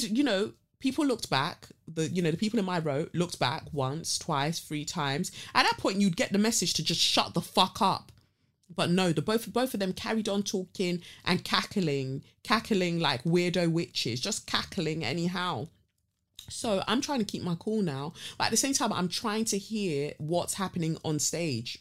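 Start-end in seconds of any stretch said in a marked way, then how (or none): none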